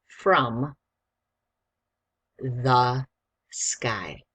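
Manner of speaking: one word at a time, long gaps between; slow, loud, word-by-word dictation. Shown as a demonstration. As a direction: spell it out word by word for a young writer. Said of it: "Do you need me to read it slower?".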